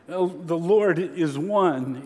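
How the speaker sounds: stretched slowly, stress on Lord; slow weighty quote. Shown as a demonstration. Preacher reading the Shema's next phrase, slow and deliberate. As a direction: give each word room. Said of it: "is the next phrase".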